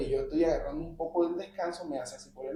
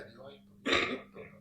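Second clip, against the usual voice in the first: muttered rambling; 5 dB quieter than usual. Retold or imitated. Drunk passenger rambling about God on the bus, muttered.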